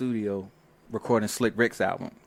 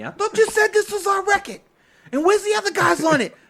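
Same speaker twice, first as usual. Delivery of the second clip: much higher, louder mock complaint; imitating angry label; 7 dB louder than usual. Label rep scolding the producer, a mock angry complaint. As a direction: play the upset label rep. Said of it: "label gets mad at me".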